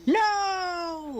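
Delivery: high, shocked cry of no; a sharp, high gasp of disbelief. Real, not acted. Reacting to shocking baseball news.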